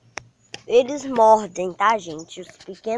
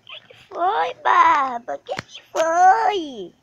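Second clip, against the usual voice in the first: pet talk; 6 semitones higher, slower than usual. Real, not acted. Cooing at the dog Barba.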